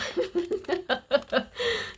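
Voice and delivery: high-pitched giggle; a run of high chuckles; light laugh. Real, not acted. Laughs after her own encouragement.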